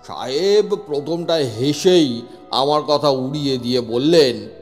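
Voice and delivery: normal talking voice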